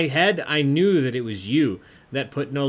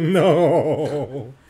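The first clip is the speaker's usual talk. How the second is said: loud, drawn-out "no" wobbling with laughter; laughing denial; 7 dB louder than usual. Real, not acted. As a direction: laugh your way through a long denial.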